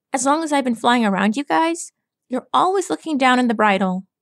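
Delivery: put-on cocky young pegasus needling a gloomy stallion; Rainbow Dash. Rainbow Dash voiced by narrator.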